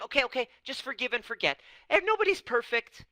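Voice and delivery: high, loud, hurried brush-off; dismissive parent. Retold or imitated. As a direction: wave it away as fast as you can.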